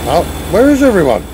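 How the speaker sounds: ordinary talk, nothing marked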